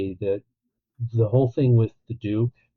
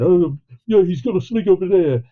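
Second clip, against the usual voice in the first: imitating a Cockney accent, pitched higher and louder; Cockney impression; 9 semitones higher, 7 dB louder than usual. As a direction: ham up a Cockney gangster accent. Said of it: "Cockney British guys going".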